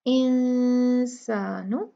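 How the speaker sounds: इंसानु with noon hidden in light ghunna; slow इंसानु, nasal noon not clear; tajweed ikhfa demo. Shown as a demonstration. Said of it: "इंसानु ऐसे पढ़ेंगे".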